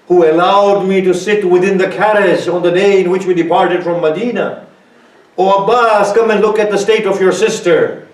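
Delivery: high, loud keening for a lost brother; wailing lament. Acted. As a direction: cry it out to Abbas at full force. Zainab's lament in majlis recital.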